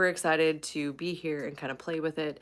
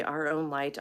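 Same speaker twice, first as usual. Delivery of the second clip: normal talking voice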